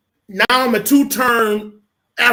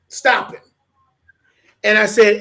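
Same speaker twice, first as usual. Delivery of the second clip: usual speaking voice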